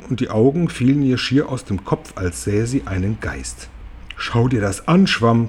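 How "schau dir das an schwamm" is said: ordinary delivery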